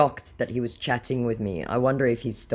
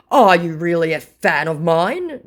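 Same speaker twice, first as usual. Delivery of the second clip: mocking scammer voice; 5 semitones higher, 8 dB louder than usual.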